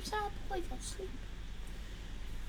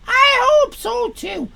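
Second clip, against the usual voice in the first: put-on little girl piping, higher and louder, slow; high girl's voice; 6 semitones higher, 8 dB louder, slower than usual. Jill answering her grandad, high girl's voice. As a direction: pipe up like a young girl.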